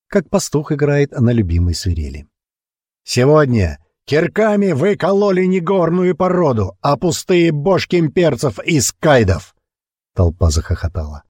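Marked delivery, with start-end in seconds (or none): 3.0-9.5 high triumphant roar; booming speech. A narrator's reading. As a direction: whip up the crowd. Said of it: "Его голос грохотал над лагерем".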